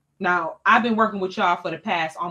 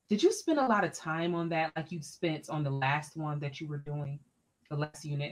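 lightly voiced boss, softer, probing; a hint of a condescending principal; 5 semitones lower, 7 dB quieter than usual. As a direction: quiz her coolly about the lesson. Principal questioning her lesson.

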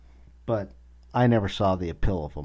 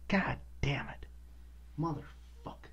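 seething whisper; 7 semitones higher, 9 dB quieter than usual. Real, not acted. Seething under his breath.

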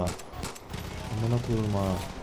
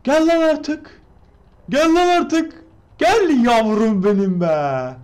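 high, loud impatient begging; high-pitched hurry-up urging; excited pleading; 12 semitones higher, 8 dB louder, much slower than usual. Real, not acted. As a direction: stretch the last plea slowly.

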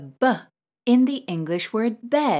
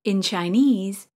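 ordinary delivery

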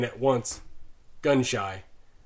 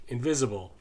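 usual speaking voice

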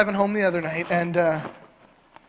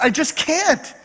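higher-pitched imitated whine; mock insistent; 6 semitones higher, slower than usual. Wife refusing to explain to husband, mock insistent. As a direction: dig in like a stubborn wife, lightly mocked.

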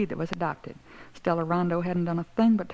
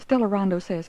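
ordinary delivery, nothing marked

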